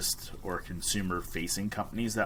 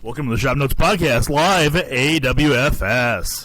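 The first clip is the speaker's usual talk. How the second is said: booming, overblown announcer voice, much louder and higher; fighting game announcer; 5 semitones higher, 12 dB louder than usual. Shown as a demonstration. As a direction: belt it out like an arena announcer. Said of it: "my announcer voice".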